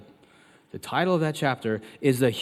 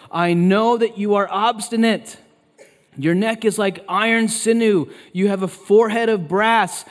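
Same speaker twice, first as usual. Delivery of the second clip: loud, high, slow preacher's rebuke; exasperated God scolding, hit each phrase hard; stern scolding; 7 semitones higher, 6 dB louder than usual. Acted. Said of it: "talked to like that".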